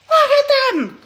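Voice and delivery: high, loud excited squeal. Real, not acted. Showing the shoes to her viewers, high loud excited squeal. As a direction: show them off, thrilled.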